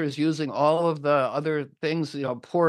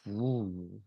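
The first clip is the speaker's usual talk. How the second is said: low, quiet "ooh"; daunted mock groan; 6 semitones lower, 8 dB quieter than usual. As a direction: sink into a soft, daunted ooh. [name] reacts to the hard question.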